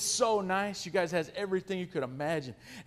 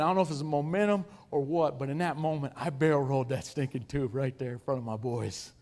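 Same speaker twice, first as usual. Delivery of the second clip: normal talking voice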